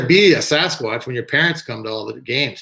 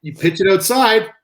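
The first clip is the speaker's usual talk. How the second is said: raised-voice mock threat from a batter; retold yell; 8 semitones higher, 5 dB louder than usual. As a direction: imitate a yelled warning, pitched up and louder. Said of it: "Penn used to yell at me".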